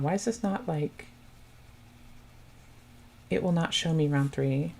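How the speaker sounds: ordinary delivery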